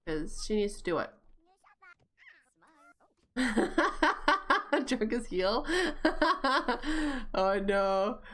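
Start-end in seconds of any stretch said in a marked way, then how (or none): 3.3-4.8 real high, rapid laughter; laughing fit. Real, not acted. Player cracks up at the game, laughing. 5.7-7.3 real high peals of laughter; laughing fit. Real, not acted. Player laughs at a drunk Sim, cracking up.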